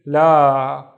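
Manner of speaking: la with a loud, drawn-out aa; long vowel. Shown as a demonstration. Teacher reading a new letter shape, la held long. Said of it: "along la".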